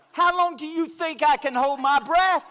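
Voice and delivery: high, loud disbelief; marvelling at his friends' claim, loud and high; incredulous. Retold or imitated. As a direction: ask it like it's absurd. Incredulous retold thought.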